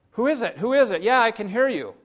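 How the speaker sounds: high, loud eager reply; urgent high-pitched call; calling back excitedly. Acted. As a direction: holler back up to the unknown voice. Jack answers the distant voice.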